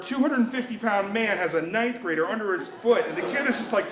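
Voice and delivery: ordinary delivery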